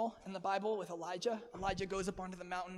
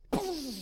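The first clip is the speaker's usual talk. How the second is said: loud, high "boom" blast; sudden booming sound effect; imitated explosion; 9 semitones higher, 6 dB louder than usual. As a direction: burst out an explosion noise. Imitating fire falling from the sky.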